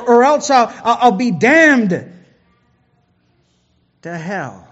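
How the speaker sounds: usual speaking voice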